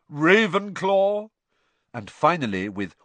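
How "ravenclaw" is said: high, loud, drawn-out announcement; hat's proclamation. A narrator's reading. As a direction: proclaim the house slowly. Hat proclaims house.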